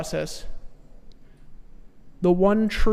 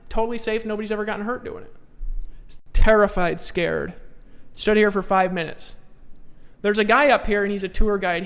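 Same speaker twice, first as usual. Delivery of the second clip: ordinary talk, nothing marked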